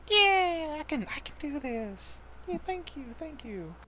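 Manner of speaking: put-on high, hopeful child voice; encouraged little girl. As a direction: find a small brave smile in it. Madeline encouraged by the party.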